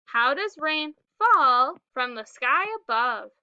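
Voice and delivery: ordinary talk, nothing marked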